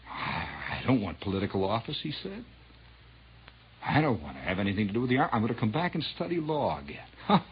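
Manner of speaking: quiet, low mock-humble pledge; mocking Castro. Host mocking Castro's false promises, quiet low mock voice. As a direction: fake modesty, rattled off quickly. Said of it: "do you remember when Castro came in?".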